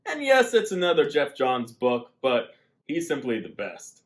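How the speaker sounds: ordinary delivery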